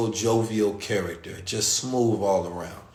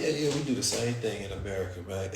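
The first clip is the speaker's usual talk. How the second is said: playing it cool; 4 semitones lower, 8 dB quieter than usual. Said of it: "I played it off".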